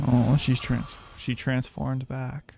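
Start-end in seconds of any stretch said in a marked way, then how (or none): none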